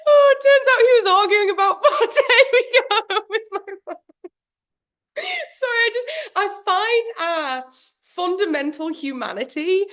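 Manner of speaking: very high, squeaky laughing speech; helpless laughter. Real, not acted. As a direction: squeeze the words out while cracking up. Helpless laughter reading a chat story.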